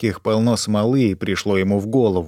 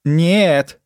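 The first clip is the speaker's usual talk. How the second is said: louder, higher sudden no; sharp cry; 6 semitones higher, 4 dB louder than usual. A narrator's reading. Donna refusing Arctor again, sharp raised cry. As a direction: snap it out as a cry. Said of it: "резко выкрикнула она".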